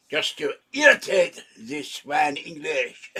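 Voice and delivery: slow, laughing fake French sneer; hammed-up French jab, laughing through it; mock Frenchman. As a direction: needle the English like a snooty Frenchman. Mock Frenchman insulting the English.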